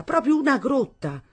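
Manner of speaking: proprio una grotta flung out high and falling, scornful; mock the house as a cave, pitched high; angry mother. A narrator's reading. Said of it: "come un'orsa inferocita".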